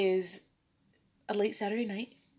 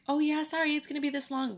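higher-pitched apologetic server voice; 8 semitones higher than usual. Pretend. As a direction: voice a quick apology to waiting customers. Voicing an apology to customers.

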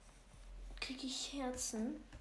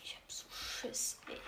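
scared whisper; 4 dB quieter than usual. Real, not acted. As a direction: whisper how scared you are. Admits fear while sneaking.

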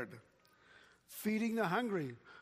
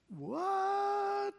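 surprised what; 3 dB louder than usual. Acted. Preacher's mock surprised outburst.

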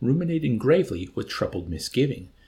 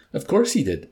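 higher, louder, emphatic yes; lavish assurance; 5 semitones higher, 5 dB louder than usual. A narrator's reading. Milo assuring Yossarian about his share, lavishly. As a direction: assure him expansively. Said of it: "Milo assured him lavishly".

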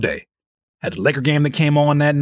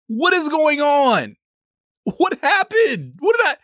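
much higher, slow, baffled cries; what is happening, high and stretched; panicked confusion; 12 semitones higher, slower than usual. Retold or imitated. To himself over the failing PC, high panicked confusion.

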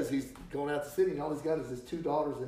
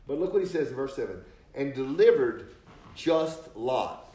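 slow, louder, hammering each phrase; fiery preaching; 3 semitones higher, 6 dB louder, slower than usual. Acted. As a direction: drive the warning home from the pulpit. Preaches the lesson at full force.

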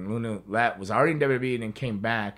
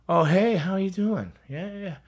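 mock sugary greeting; 12 semitones higher, 8 dB louder than usual. Imitating China's fake-friendly greeting.

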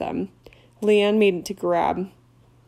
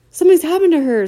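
higher, louder distraught sob; sobbing; 9 semitones higher, 11 dB louder, faster than usual. Acted. Leanne to Hagrid, sobbing. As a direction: cry it out in distress. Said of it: "sobbed Leanne".

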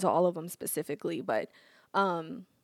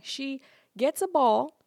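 usual speaking voice

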